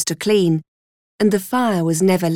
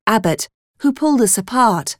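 ordinary delivery

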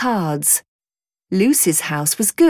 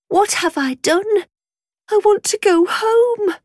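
put-on frightened girl; 13 semitones higher than usual. Lost frightened girl thinks aloud.

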